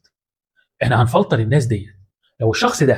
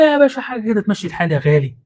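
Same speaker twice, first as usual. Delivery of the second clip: higher, breezy dodge of a nosy question; pitched-up mock friendly deflection; mock evasive sweet-talk; 7 semitones higher than usual. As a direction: brush him off sweetly with a fake smile. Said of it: "قول له".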